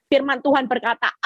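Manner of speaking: usual speaking voice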